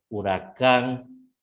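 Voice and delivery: slow "huracán", stress on final cán; stress demonstration. Shown as a demonstration. Teacher giving students an accent example, slowly stressing cán in "huracán". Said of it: "para cargar el tono de la letra".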